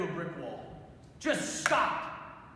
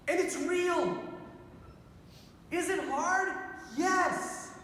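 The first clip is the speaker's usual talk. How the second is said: high, impassioned sermon delivery; fervent preaching; 10 semitones higher than usual. Real, not acted. Priest telling the crowd about joy and grace, fervent. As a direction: preach it with rising zeal.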